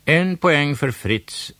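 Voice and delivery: loud host announcement; called out. A narrator's reading. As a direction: announce the point to the hall. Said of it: "ropade Måns".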